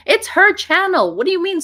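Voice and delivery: high, loud indignant protest; indignant outburst. Real, not acted. To the haters defending Paula's channel, indignant and loud. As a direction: defend her friend, outraged.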